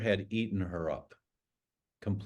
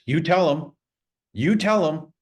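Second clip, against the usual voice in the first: loud and fierce, high and pressing; fierce command; 8 semitones higher, 10 dB louder than usual. Retold or imitated. Dying godmother charging her godson, loud and fierce. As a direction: order him told with fire in the eye. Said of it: "she gets this fire in her eye".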